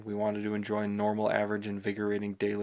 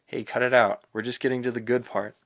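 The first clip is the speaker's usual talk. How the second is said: annoyed; 2 semitones higher, 7 dB louder than usual. A narrator's reading. Annoyed at shoulder tap.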